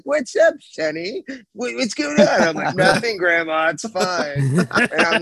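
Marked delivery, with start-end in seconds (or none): 0.0-2.4 high-pitched grandma voice; imitated grandma. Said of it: "My grandma's like". 2.8-4.4 feigned nonchalance. Retold or imitated. Retold cover-up to his grandma.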